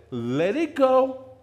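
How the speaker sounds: high, loud and slow; urgent command. Retold or imitated. Church mother urging young Justin, loud and slow. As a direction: stretch out the order.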